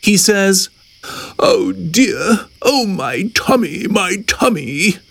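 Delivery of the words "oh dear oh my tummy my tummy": higher, mildly pained wailing; tummy-ache moaning. A narrator's reading. Hippo complains of tummy ache, high mild moaning. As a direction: moan in a raised, storybook voice.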